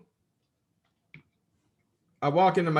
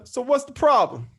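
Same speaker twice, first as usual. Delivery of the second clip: higher, louder mock challenge; dad's punchline; 8 semitones higher, 5 dB louder than usual. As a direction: deliver the zinger.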